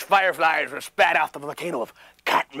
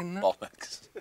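a whispered British "Bollocks"; a hushed British-accented curse; 8 semitones lower, 9 dB quieter than usual. Shown as a demonstration. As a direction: breathe it out like a posh Brit. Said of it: "my fine British accent".

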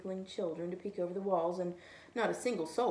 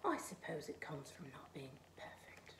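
muttered; 4 semitones lower, 9 dB quieter than usual. A narrator's reading. Snowman grumbles to himself after losing his nose, whispered. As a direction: mutter it under your breath, sour. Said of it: "he muttered".

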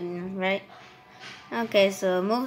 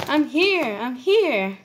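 calling out; 5 semitones higher, slower than usual. Acted. Ingers calling out arrival.

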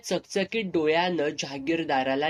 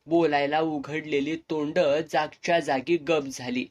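usual speaking voice

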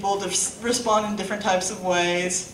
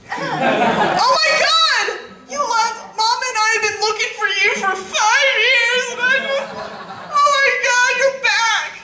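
much higher, laughing-crying reunion cry; mock tearful reunion with a stranger; overjoyed long-lost daughter; 12 semitones higher than usual. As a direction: gush like a daughter finding her lost dad. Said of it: "I'm gonna be like".

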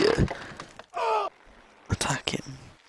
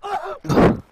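pained high grunt; 5 dB louder than usual. Real, not acted. Hit by a trap in game.

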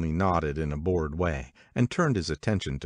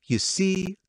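high triumphant cry; 14 semitones higher than usual. A narrator's reading. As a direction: crow it as a point proved. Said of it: "cried Catherine triumphantly".

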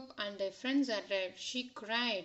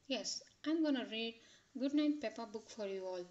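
ordinary delivery

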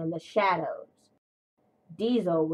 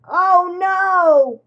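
a much higher, much louder terrified wail; 11 semitones higher, 12 dB louder than usual. A narrator's reading. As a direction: cry out as the crash hits. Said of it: "wailed James".

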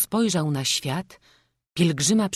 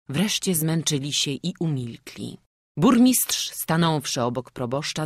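plain reading voice

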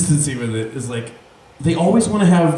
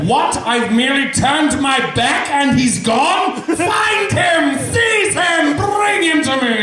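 high, shrill shouted commands; villain shouting orders; 8 semitones higher, slower than usual. Acted. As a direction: bark orders at the guards. Sheriff orders guards.

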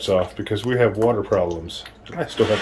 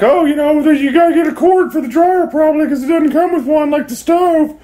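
much higher, put-on old lady voice; 3 dB louder than usual. Telling the camera what the old lady advised, high old lady voice. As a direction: mimic her chatty advice in a high voice. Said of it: "The old lady's like".

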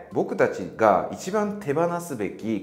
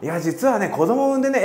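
higher and louder, bursting with news; sharing news; 10 semitones higher, 4 dB louder than usual. Pretend. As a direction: tell the big news happily. Friend shares news across room. (友人が出産を嬉しそうに伝える声)

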